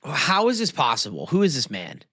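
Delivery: ordinary delivery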